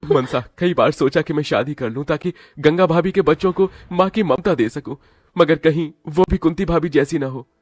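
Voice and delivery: man confiding his fear of a cruel stepmother; worried young man. Acted. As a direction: speak his worry for the children. Man voiced in story.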